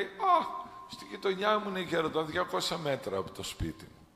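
put-on high, surprised young voice; the boy spotting his home, excited. (ενθουσιασμένο παιδί, ψιλή φωνή)

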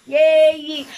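loud, high cheer of joy; excited cheer. Real, not acted. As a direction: burst out with a happy yay. Cheers arriving in America.

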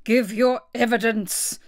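slow, stern royal demand; cross king laying down the law; angry. A narrator's reading. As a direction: demand it angrily, word by word. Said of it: "the king repeated angrily".

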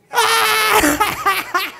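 shrieking high laugh. Real, not acted. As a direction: squeal with laughter. Reacting to the question.

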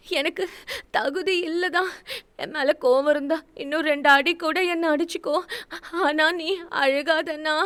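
put-on high, crying young woman's voice; tearful pleading. A narrator's reading. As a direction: plead through tears. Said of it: "தன் அண்ணனின் அழுகையை".

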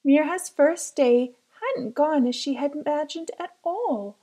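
usual speaking voice

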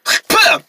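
sharp, high spit sound; spitting. Acted. Boy practicing distance spitting, spitting sound. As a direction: spit it out hard. Spits, lands on the other character.

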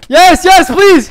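high, loud real begging; desperate pleading. Real, not acted. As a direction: plead at the top of your voice. Begs attackers to stop.

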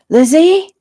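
loud, high searching call. A narrator's reading. Arriving officer calling for Lizzie, searching shout. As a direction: call her name loudly, pitch rising at the end. Rescuer calls out from another room.

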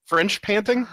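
breathy mock pant, high; comic French-style panting. Shown as a demonstration. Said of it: "that's how you pant in French".

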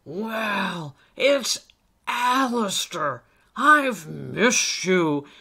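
loud, jovial welcome; hearty old elf. Acted. Old elf welcomes Alistair and invites him inside, hearty. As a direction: greet a dear little friend heartily. Nicholas greets his friend.

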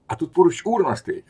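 usual speaking voice

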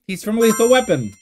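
ordinary delivery

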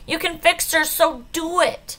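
angry demand. A narrator's reading. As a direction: insist furiously, drawing out the last words. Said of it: "says Uriah".

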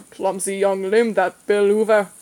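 put-on slow, cross grumble in a country accent. A narrator's reading. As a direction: scold like a crabby little old fisherman. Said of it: "he said crossly".

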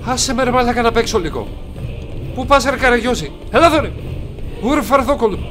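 high, loud jeering at an opponent; loud heckling. Real, not acted. Gamer calls the fleeing enemy names, loud high heckling.

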